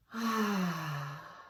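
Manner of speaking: audible, drawn-out breath out; long exhaling sigh. Real, not acted. Exhaling out during breathing exercise.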